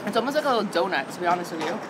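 chewing while talking; talking around hot food; mouth full. Real, not acted. Seen 3: Lips pursed, cheeks full, chewing after bite, face clearly visible.

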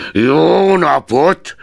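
high, loud and slow Jó napot; fed-up old miser cutting the nephew off; curt dismissal. A narrator's reading. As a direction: bark the goodbye to shut him up. Scrooge dismisses nephew again.

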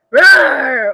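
loud roaring bear. A narrator's reading. Old Bear roaring over losing the nuts, loud. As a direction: let out a big angry roar. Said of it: "Old Bear roared".

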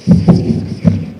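rapid, voiceless snake hissing; stuttered snake-like hiss; anaconda hiss. Retold or imitated. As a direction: imitate a flickering forked tongue in short hisses. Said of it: "it kinda goes".

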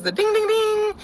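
high, sung ding ding ding; chime imitation. Imitating the Lyft app chimes for the audience, high and sung.

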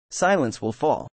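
lightly voiced sinister rasp. A narrator's reading. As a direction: rasp it out like a menacing voice. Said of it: "a sinister voice rasping".

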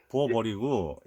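ordinary talk

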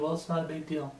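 soft, offhand mock indifference; imitating a careless, dismissive attitude; nonchalant. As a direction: shrug it off under your breath. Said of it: "have this nonchalant attitude".

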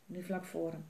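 plain reading voice

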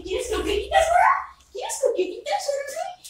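put-on very high sing-song baby talk; dog baby talk. To the little dog with croquettes, high baby talk.

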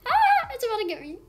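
high, loud, fast frightened squeal; panicked squeal. Real, not acted. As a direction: squeal as the snake closes in. Snake closing in on her.

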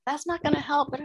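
put-on high, teasing kid's protest; high, playful child's refusal; mimicking son, half joking. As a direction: pitch way up, playfully stubborn.

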